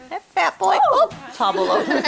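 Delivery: high, laughing coo at the dog; laughing pet voice. Real, not acted.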